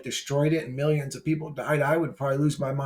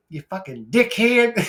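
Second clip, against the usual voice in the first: higher, louder mock Australian dickhead; Australian accent; 7 semitones higher, 5 dB louder than usual. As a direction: toss off the insult like an Aussie bloke. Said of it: "my fucking Australia".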